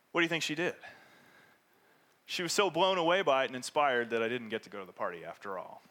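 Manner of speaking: ordinary talk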